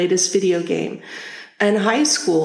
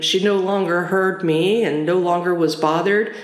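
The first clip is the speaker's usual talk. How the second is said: ordinary talk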